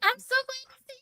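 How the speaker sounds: high, eager hello to everyone; excited greeting. Real, not acted. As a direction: welcome them in with joy. Welcoming raid viewers.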